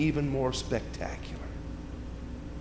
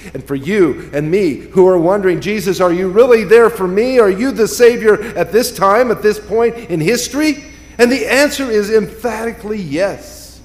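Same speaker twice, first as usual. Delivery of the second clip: louder, higher, driving preaching; emphatic preaching; 6 semitones higher, 7 dB louder than usual. Acted. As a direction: build to an emphatic yes. Building to emphatic yes.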